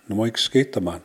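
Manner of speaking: "Na moja, ikke skete man" run together without pauses; "Na moja, ikke skete man" contracted into one smooth phrase; fluent-speed demo. Shown as a demonstration. Same phrase modelled at fluent speed.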